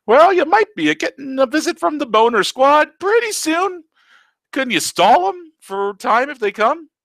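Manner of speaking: put-on old uncle teasing his niece in a silly voice; teasing old Taco. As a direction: tease her like a cheeky ancient uncle. Taco voice long speech.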